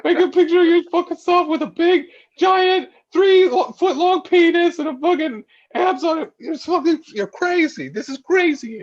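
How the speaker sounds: high, loud hammed-up rant; imitating a friend yelling in disbelief; mock angry yell. Retelling Dan yelling at him over the photo, high mock yell. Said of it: "He goes".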